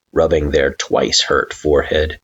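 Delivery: ordinary delivery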